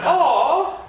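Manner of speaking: high, drawn-out mock "aw"; exaggerated high-pitched aww; mock pitying aww. Imagined sympathetic comment on photos.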